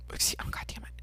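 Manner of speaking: whispered, annoyed, under the breath; whispered curse. Real, not acted. Whispering a curse to herself after a burp. Burp interrupts her podcast talk.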